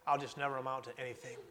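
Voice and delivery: quick, soft mutter of defeat; muttered self-doubt. Acted. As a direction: mumble it under your breath, giving up. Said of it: "We hear that saying".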